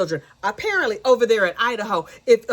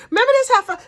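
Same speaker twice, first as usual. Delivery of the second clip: higher, louder sassy call-out; rally the judge against her; mock prosecutor outburst; 13 semitones higher, 6 dB louder, much faster than usual. Prosecutor calling out the defendant to the judge, loud and high. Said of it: "He was like".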